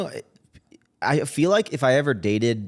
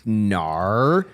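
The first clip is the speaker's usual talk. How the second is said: echoed mock-Australian "no"; questioning no in an Australian accent; 4 semitones lower than usual. Host echoes the guest's joke, in Australian accent. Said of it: "people with Australian accents".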